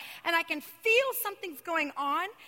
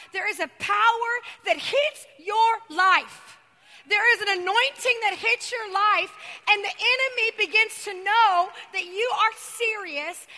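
shouted preaching; 4 semitones higher, 8 dB louder than usual. Acted. To the congregation, shouting that power hits your life. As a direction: proclaim it like a revival peak.

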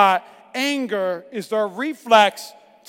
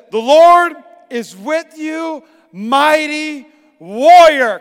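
slow, loud and grand, every word stretched out; booming proclamation; 4 semitones higher, 7 dB louder, much slower than usual. Shown as a demonstration. Preacher proclaiming God's words to Gideon, booming slowly. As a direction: announce it like a herald. And this is what it said.

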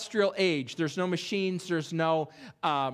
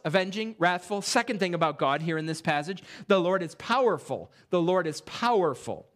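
ordinary talk

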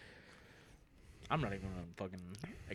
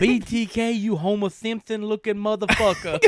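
higher, louder trash-talk promo; wrestling promo; 8 semitones higher, 5 dB louder than usual. Acted. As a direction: yell it at him like a heel. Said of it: "I'd cut a promo on him first".

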